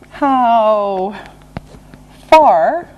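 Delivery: drawn-out words, long pause between; slow and deliberate. Shown as a demonstration. Teacher introducing a distance question, slow and separated. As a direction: stretch each word and separate them. Slow model phrase for learners.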